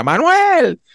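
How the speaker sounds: put-on high, far-off calling voice of an old woman; imitating a grandmother shouting for her husband; calling out grandma.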